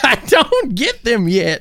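prospector comic. Pretend. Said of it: "old prospector does a tight five".

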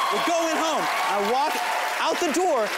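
ordinary delivery

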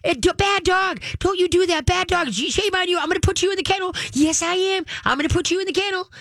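high-pitched, ineffectual scolding; squeaky mock telling-off of a puppy; voice goes up. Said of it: "their voice goes up".

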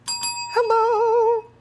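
very high squeaky hello; a tiny puppet greeting; squeaky mascot voice. Acted. Voicing the mascot Gladys.

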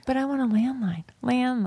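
breathy whispered aside. Real, not acted. Confessing to her cohost she wants a landline, whispered.

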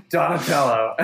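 laughing out the name, pitch jumping up. Real, not acted. Laughing at the interviewers' silly Donatello matchup. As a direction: crack up as you say it.

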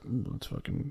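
hushed whisper to himself; retold whispered remark; whispered aside. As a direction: whisper it so he won't hear. Aside about comedian entering.

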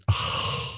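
long, unvoiced exhale of relief; relieved sigh. Buyer after the title went through, relieved sigh. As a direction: let out a big acted sigh.

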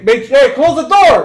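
loud, slow, yelled command; shouted order. Retold or imitated. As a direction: yell it across the house. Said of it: "how many times did you hear me say".

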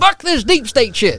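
high, loud Southern mock outrage; comic Southern holler; Southern accent. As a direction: shout it as an indignant Southern caricature. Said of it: "I do that in a Southern accent".

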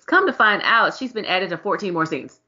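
ordinary talk

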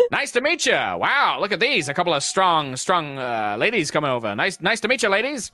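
high, swooping goblin voice; excited goblin. Acted. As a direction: greet them like an over-friendly goblin. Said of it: "the goblin looks around and he's just like".

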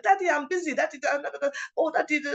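high-pitched impatient daughter; mock-harried, high babbling retort; telling dad off. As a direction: imitate a busy daughter brushing off her father. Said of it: "telling her dad off on the phone".

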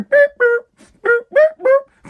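high, short electronic-style boops; imitated beeps. Imitating the coronavirus rolling up, high beeps. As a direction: beep like a little machine rolling up.

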